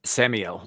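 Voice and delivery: Samuel said as "SAM-ee-el", three syllables; Samuel like Daniel. Shown as a demonstration. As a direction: pronounce Samuel to end in "ee-el". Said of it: "like Daniel, but with Sam".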